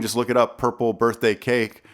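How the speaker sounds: ordinary talk, nothing marked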